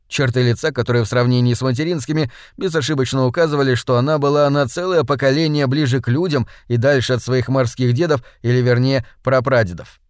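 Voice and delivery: ordinary delivery, nothing marked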